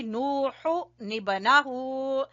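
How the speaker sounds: नूहु निबनाहु drawn out very slowly, with the n joined onto निबनाहु; slow Quranic recitation. Shown as a demonstration. Teacher demonstrating noon qutni to her class, slow recitation. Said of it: "यहाँ हमने नून कुतनी को पढ़ा".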